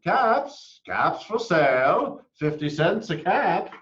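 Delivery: high, loud peddler's call; loud, high-pitched hawker's cry; calling out wares. A narrator's reading. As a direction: sing it out to the street. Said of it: "he called".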